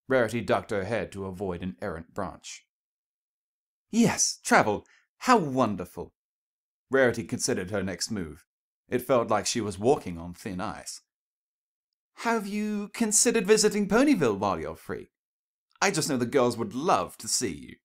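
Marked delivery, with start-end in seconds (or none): 3.9-6.1 high, louder refined lady voice; Rarity voice. Acted. Rarity feigning delight at Dash's travels. 12.2-17.8 much higher, refined lady voice; Rarity voice. Acted. Rarity urging Dash to visit Ponyville, high and refined.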